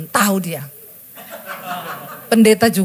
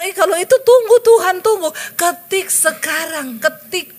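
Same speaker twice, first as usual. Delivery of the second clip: much higher, louder hasty protest; much higher, louder hasty protest to God; alarmed protest; 9 semitones higher, 3 dB louder than usual. Retold or imitated. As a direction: blurt out a quick hold-on to God.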